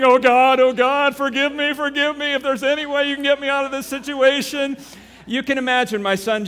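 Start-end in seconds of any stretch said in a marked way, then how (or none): 0.0-4.8 high, louder tearful pleading; retold crying prayer on his knees. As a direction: beg God on your knees, sobbing. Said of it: "he's crying, he's praying".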